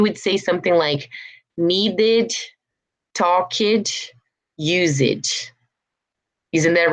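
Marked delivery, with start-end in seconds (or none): 1.6-5.5 "Needed, talked, used" with every -ed a full syllable; spelled-out mispronunciation. Shown as a demonstration. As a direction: say "talked" as talk-id, "used" as use-id. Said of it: "You wanna say all the".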